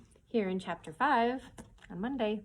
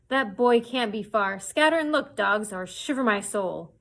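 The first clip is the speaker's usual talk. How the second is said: angry cry; 7 dB louder than usual. Acted. Pew driving the crew to search, angry shout. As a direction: whip the men out to search. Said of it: "he cried".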